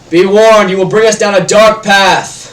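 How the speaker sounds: loud, high prophetic proclamation; grand orator's cry; booming warning. Acted. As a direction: thunder out a dire warning. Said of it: "his voice is booming".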